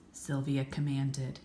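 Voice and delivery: ordinary delivery, nothing marked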